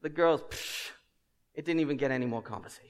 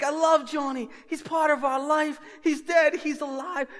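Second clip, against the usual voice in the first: much higher, louder mock-anguished cries; emotional outburst; 11 semitones higher, 4 dB louder than usual. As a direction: ham up the grief then the relief. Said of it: "the emotions I went through".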